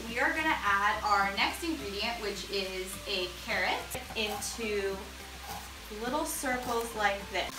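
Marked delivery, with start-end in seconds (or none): none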